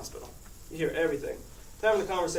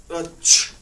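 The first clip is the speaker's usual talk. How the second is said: mock stifled sneeze; 11 dB louder than usual.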